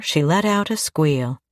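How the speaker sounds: ordinary delivery, nothing marked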